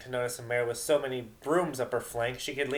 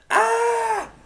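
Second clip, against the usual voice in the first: shrill high scream; loud startled shriek; 12 dB louder than usual. Acted. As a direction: scream as she's tackled. Vinyl attacked.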